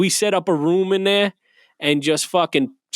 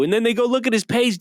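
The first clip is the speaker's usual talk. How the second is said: normal talking voice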